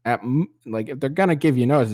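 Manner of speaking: ordinary talk, nothing marked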